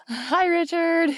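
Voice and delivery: high, slow friendly hello; calling out. Real, not acted. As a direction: sing out a greeting. Calling out to Richard.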